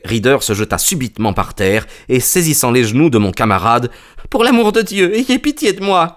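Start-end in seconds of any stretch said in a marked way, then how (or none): 4.3-6.1 high-pitched, desperate begging; crying out in pleading. A narrator's reading. Culprit at the detective's knees, crying for mercy. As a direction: beg for mercy in a high, frantic voice. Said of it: "cria-t-il".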